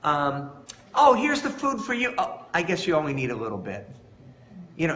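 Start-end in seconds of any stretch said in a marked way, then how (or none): 0.9-2.1 lightly voiced catty sweetness, higher and louder; mock-kind rival offering food; a hint of sugary condescension; fake sweet. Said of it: "She might say". 2.2-3.9 quick dismissive dig, fast; lightly played catty rival; snide aside. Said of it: "She might say".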